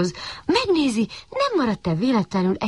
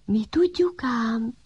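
mysterious whisper; 5 semitones higher, 3 dB quieter than usual. A narrator's reading. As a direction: tease from hiding, hushed. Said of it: "Hallatszott hirtelen a háta mögül". (titokzatos suttogás)

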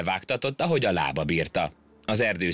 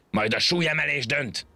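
roaring monkey; 8 semitones higher than usual. Acted. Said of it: "ordította".

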